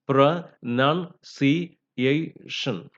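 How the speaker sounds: pronunciation in five slow, separated syllables. Shown as a demonstration. Teacher counts out five syllables of pronunciation, slowly separated. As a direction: break pronunciation into pro-nun-ci-a-tion, very slowly. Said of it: "there are five syllables".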